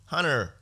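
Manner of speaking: Hunter with no T, the T swallowed into an N; mock American Hunter. Mocking how Americans say the son's name, Hunter as Hunner. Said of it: "Did they even say the T?".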